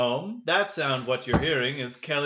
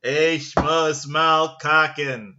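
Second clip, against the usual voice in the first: slow, much louder mock German proclamation; mock German speech; 8 dB louder, much slower than usual. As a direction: announce it slowly and loud like a speech. Mock JFK Berlin speech.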